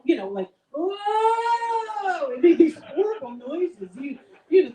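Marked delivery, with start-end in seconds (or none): 0.7-2.3 long, high mock groan; hammed-up groan, held and raised; imitated groan. Comic imitating the couple's groan on landing, hammed-up groan. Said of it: "So we both kind of groan".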